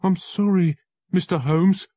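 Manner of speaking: high, loud and panicked; scared young lawyer bursting in; frightened cry. Acted. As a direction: blurt it out in fright. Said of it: "he cried".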